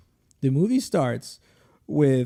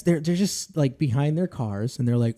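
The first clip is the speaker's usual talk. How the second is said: usual speaking voice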